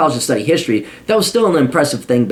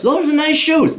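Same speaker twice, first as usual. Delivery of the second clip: compliment said much higher, sing-song; high mock compliment; 12 semitones higher than usual. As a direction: raise the pitch on "Those are nice shoes.". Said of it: "Never said that in my life".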